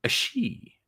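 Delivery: put-on lisping Castilian Spanish accent, pitched high; mock Castilian lisp on every s. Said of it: "I lisp a lot because that's how they talk in Spain".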